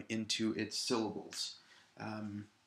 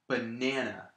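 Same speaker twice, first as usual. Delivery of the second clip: "banana" with the middle syllable stressed. Shown as a demonstration. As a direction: say "banana" the standard way, leaning on NAN. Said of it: "putting the stress on the middle syllable".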